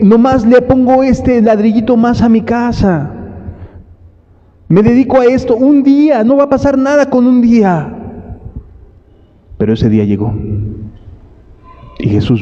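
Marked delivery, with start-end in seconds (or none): 0.0-8.0 careless excuse. Retold or imitated. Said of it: "Porque dije".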